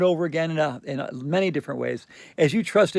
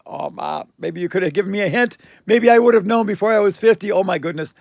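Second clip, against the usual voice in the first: from a breathy "Oh, Mom" up to a loud, high protest; exasperated building outcry; 6 semitones higher, 8 dB louder than usual. Retold or imitated. To mom who kept it secret, exasperated cry building loud and high. Exasperated comeback to her mother.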